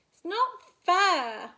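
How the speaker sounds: loud, slow, high protest; losing team shouting at the others; angry shout. Acted. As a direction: shout it like a wronged kid.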